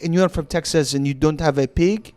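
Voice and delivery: put-on disbelieving French student's accented English. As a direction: imitate a baffled French kid questioning a Texan. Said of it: "And they were like".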